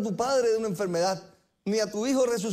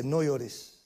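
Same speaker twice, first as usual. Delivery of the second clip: tender consolation; 8 semitones lower, 3 dB quieter than usual. Acted. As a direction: lower and softer, gentle reassurance. Jesus voiced consoling woman.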